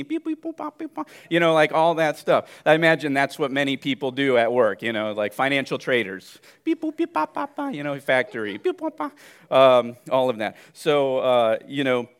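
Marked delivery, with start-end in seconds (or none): 0.0-1.1 high playful machine beeps; silly computer beeping sounds; beep boop noises. Retold or imitated. Imitating busy working machine. 6.6-7.7 high playful machine beeps; silly computer beeping sounds; beep boop noises. Retold or imitated. Imitating trader at keyboard. 8.6-9.1 high playful machine beeps; silly computer beeping sounds; beep boop noises. Retold or imitated. Imitating factory work.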